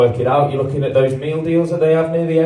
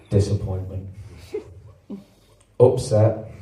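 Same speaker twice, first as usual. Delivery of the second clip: mock sadness; 7 semitones lower, 8 dB quieter than usual. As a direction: list the sorrows like a eulogy. Mock-sad list of feelings.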